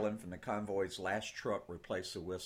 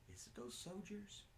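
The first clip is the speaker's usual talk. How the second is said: put-on soft boy's voice; 11 semitones higher, 15 dB quieter than usual. Boy asks softly.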